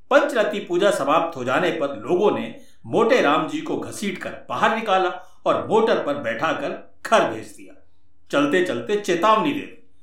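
plain reading voice

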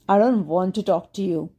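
ordinary delivery

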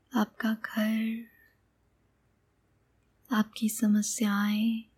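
calm meditation guide, long pauses between phrases; guided meditation. Acted. Meditation guide leads listener on an imagined sky journey, calm and unhurried. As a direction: guide the listener gently, leaving space after each phrase.

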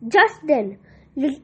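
plain reading voice

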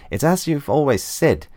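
hammed-up cheerful flunky; Mister Groin quote. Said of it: "Mister Groin goes on to say".

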